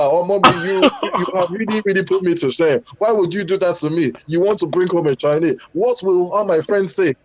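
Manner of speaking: put-on high, wailing Nigerian accent, ashamed mother; Nigerian mum accent. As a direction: wail like a Nigerian mum shamed by her son. Mother's lament continued in accent.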